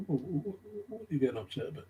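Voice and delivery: high-pitched, stammering retold question; flustered mock alarm. Retelling his reaction when she started crying, flustered and high. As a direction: act out being thrown by someone's tears.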